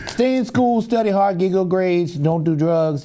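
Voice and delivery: high mock pep talk, falling as it lists; Ronnie Coleman. Lifter advising kids between reps, imitated high pep talk. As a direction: rattle off advice to kids in imitation.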